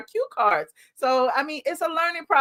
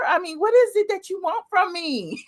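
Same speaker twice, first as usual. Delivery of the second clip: high, mock-tearful plea; hammed-up helpless begging; mock pleading; 6 semitones higher than usual. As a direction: plead in a put-on whiny cry. Mock pleading, then laughs.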